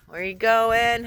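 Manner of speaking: ordinary talk, nothing marked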